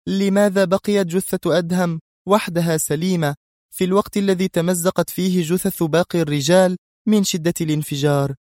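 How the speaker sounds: high-pitched, measured probing; composed boss questioning. A narrator's reading. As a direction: press him for an explanation. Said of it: "هزت رأسها في هدوء وهي تسأله".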